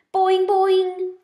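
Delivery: high, loud boing; a frog calling out. A narrator's reading. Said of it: "Og sounded".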